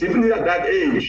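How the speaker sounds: usual speaking voice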